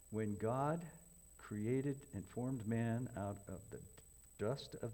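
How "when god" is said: ordinary talk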